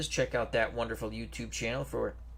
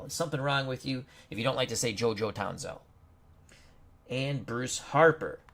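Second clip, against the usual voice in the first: ordinary talk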